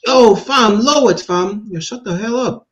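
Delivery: high, mocking Jamaican street slang; Jamaican accent. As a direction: imitate a fake Jamaican brushing someone off. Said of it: "It's like".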